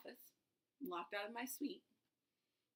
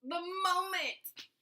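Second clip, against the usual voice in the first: "The moment" slow, much higher and louder; emphatic "The moment"; 10 semitones higher, 11 dB louder, much slower than usual. Real, not acted. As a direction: stretch out "The moment" with full force. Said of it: "the moment you tell me".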